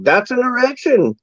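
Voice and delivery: hammed-up indignant German patient. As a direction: play the insulted patient huffing. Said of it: "the German guy's like".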